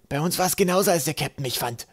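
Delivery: high-pitched little character voice; eager crewmate Trapp. A narrator's reading.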